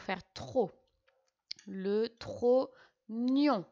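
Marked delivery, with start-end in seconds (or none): 1.7-3.6 "Le trognon" stretched very slowly, each syllable set apart and loud; teacher drawing out "trognon" syllable by syllable. Shown as a demonstration. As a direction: spell out "trognon" slowly for the dictation, tro then gnon. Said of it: "G N pour faire gne".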